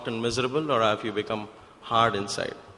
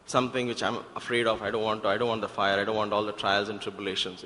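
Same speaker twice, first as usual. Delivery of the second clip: ordinary talk, nothing marked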